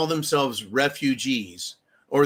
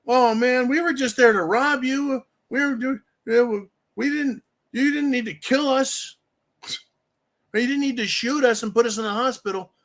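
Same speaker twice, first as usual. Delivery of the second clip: higher, aggrieved whine of a wounded intruder; mock whiny burglar; 7 semitones higher than usual. Shot burglar complaining to homeowner, higher mock whine. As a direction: play the burglar playing victim.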